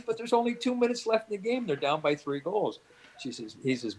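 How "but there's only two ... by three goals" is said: protesting. Retold or imitated. Mildred arguing with husband to leave game, protesting. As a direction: act out a wife's higher-voiced objection.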